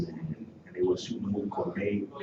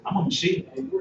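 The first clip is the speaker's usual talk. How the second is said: proud boast; 4 dB louder than usual. Retold or imitated. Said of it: "she said".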